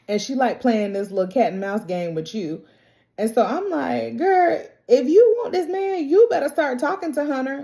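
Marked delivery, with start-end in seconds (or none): 4.2-7.6 put-on high-pitched, loud girlfriend advice; playful exasperated pleading; exasperated scolding. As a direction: scold a friend in a raised, insistent voice. Mock scolding advice to a character.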